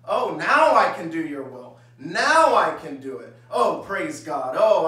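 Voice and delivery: loud, emphatic show of eagerness; mock devotion. Preacher imitating a blessed believer, loudly over-eager. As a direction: play up the believer's sudden willingness.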